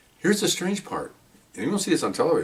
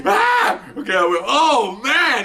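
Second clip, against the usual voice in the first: high excited exclamations; 13 semitones higher, much slower than usual. Real, not acted. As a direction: relive the shock, pitched way up. Reliving the shooting, excited.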